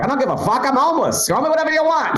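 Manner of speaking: very high, strained retort; mock exasperated shout. Homeless guy answers the question, exasperated mock shout. Acted homeless man's outburst.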